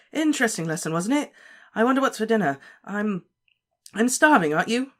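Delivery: a scared boy pretending all is fine; unnaturally high. A narrator's reading. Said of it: "his voice much higher than usual".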